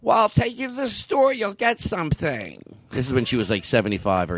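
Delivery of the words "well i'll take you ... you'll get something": put-on higher, old Philly grandma voice; a grandson's impression of his old Philly grandma; grandmother impression. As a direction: play his elderly grandmother nagging. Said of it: "I used to do my grandmother".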